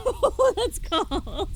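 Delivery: startled high laugh; surprised laugh. Real, not acted. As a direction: yelp and laugh at cold. Cold water shock laughing.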